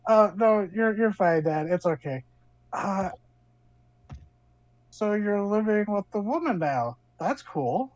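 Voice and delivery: a hint of a teenage daughter; daughter's voice. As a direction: reassure dad, then tease him gently. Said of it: "your daughter".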